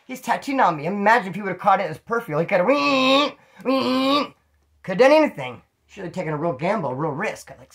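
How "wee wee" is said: high droning "weee" buzz; drawn-out, high-pitched machine whine; imitated tattoo gun. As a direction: mimic a tattoo machine whining.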